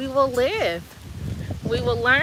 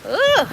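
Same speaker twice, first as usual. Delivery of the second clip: disgusted groan; 10 semitones higher, 6 dB louder than usual. Real, not acted. Reacting to sticky humidity.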